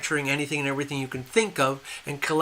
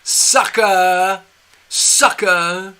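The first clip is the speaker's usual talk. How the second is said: loud, higher, drawn-out jeer; gloating taunt; 4 semitones higher, 7 dB louder, much slower than usual. Acted. Leader jeering at duped Americans, loud gloating taunt. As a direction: crow it at the person you have conned. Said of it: "they're laughing at us and going".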